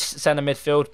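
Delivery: ordinary talk, nothing marked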